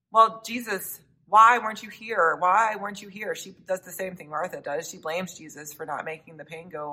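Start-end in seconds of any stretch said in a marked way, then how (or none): 0.1-3.4 higher and louder, a pleading outcry; anguished cry. Acted. Mary crying out at Jesus for not coming, louder and higher. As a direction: cry it out at him in grief. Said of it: "And then Mary says".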